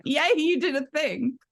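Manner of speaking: high, bubbly mock cheer for a partner; cheery cheer. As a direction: squeal it like a proud cheerleader. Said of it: "we're both just constantly like".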